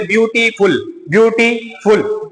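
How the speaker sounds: "ब्यूटीफुल" slowly split into ब्यू-टी-फुल; syllable by syllable. Shown as a demonstration. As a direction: stretch "ब्यूटीफुल", pausing before the final फुल. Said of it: "ऐसा लगता है कि दो तीन अक्षर मिलके". ("ब्यूटीफुल" को धीरे-धीरे अक्षरों में तोड़कर)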